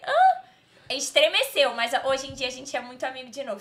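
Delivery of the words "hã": high, loud startled huh; shocked. Retold or imitated. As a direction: squeak it out in disbelief. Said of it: "eu falei".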